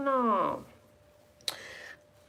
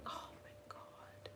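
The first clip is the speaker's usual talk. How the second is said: whisper; 29 dB quieter, slower than usual. Real, not acted. Whispering about being hungry.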